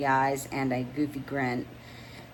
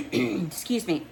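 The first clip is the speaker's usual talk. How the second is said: pointed throat clearing; 3 semitones higher, slower than usual. Acted. Rennie fakes throat clearing.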